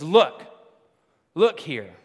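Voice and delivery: sharp, loud "look, look here"; angry retort. Retold or imitated. Elder son snapping loudly back at his father.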